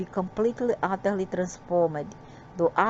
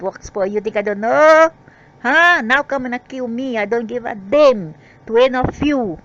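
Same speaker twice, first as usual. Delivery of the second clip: loud, high shouting defiance; furious rant; 4 semitones higher, 6 dB louder than usual. Real, not acted. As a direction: yell it at the doubters, daring them.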